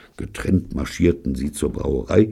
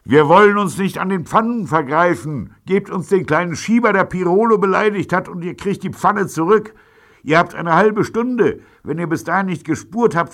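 high, loud megaphone-like gangster call; loud, much higher hailing voice of a gang boss; through a megaphone; 6 dB louder than usual. A narrator's reading. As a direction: bellow the ultimatum across the street. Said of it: "sprach durch eine Flüstertüte".

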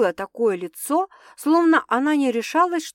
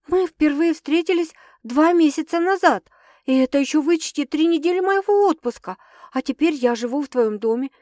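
put-on higher, louder voice of an upset girlfriend; heated young woman; 5 semitones higher, 3 dB louder than usual. A narrator's reading. Sasha accusing Stas of treating her lightly, upset. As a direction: pour out her worries in a rising rush.

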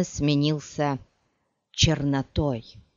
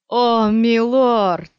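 very slow, loud, higher anguished moan; groaning; 7 semitones higher, 8 dB louder, much slower than usual. Acted. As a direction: mourn over the blinded friend. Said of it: "простонал Стилгар". (протяжный стон, громко и выше)

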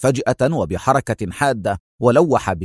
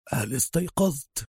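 hushed, slightly higher-pitched murmur; murmur from darkness; 5 semitones higher, 8 dB quieter than usual. A narrator's reading. Unseen voice asking Adham if he is awake, murmuring. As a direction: murmur it very softly out of the dark. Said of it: "ارتفع صوت من أعماق الظلمة يغمغم".

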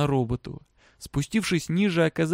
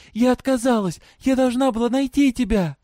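put-on high, loud girl's voice; impassioned girl; 10 semitones higher, 5 dB louder than usual. A narrator's reading. Girl explaining herself to her father, impassioned. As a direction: declare it high and insistent.

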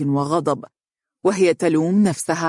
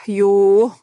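higher-pitched, impatient huff; weary exasperation; 4 semitones higher, 6 dB louder, much slower than usual. A narrator's reading. Daughter sick of her mother's questions, huffing with exasperation. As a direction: sigh out, then a loud fed-up exclamation. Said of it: "زفرت دنيا متبرمة وهي تقول بملل".

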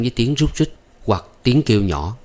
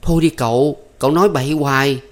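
pulling away, firm and higher; firm refusal; 5 semitones higher, 3 dB louder than usual. Acted. Maid pulling her hand from the young master, firm refusal. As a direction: brush him off firmly. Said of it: "Thắm giật mạnh tay ra".

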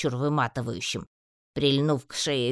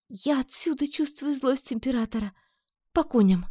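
high character voice for a weary woman; whispering Amazon; 11 semitones higher than usual. A narrator's reading. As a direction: voice her high above the narration. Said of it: "прошептала она".

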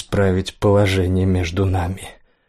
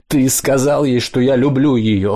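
higher, anguished accusation; voice breaking; 4 semitones higher than usual. A narrator's reading. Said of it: "его голос сорвался".

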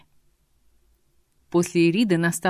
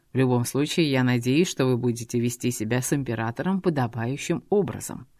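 low male voice; 6 semitones lower than usual. Acted. Eragon warns the dragoness coldly.

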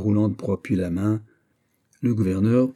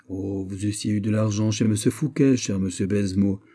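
plain reading voice

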